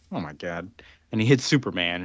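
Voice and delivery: ordinary talk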